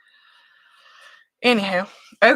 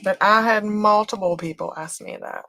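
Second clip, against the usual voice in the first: ordinary delivery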